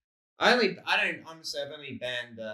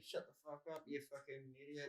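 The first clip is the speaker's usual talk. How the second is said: muttered insult; 17 dB quieter than usual. Retold or imitated. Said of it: "you can't, like, say".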